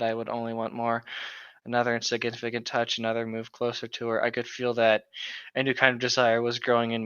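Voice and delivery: steady, slightly hushed book narration; dramatic reading. Acted. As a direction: read the passage like a dramatic novel.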